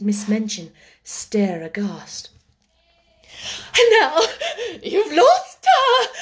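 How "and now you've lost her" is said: high, loud, drawn-out hysterical wail; overwrought, gasping cry; wild cry. A narrator's reading. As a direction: wail it wildly, half crying. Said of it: "she cried wildly".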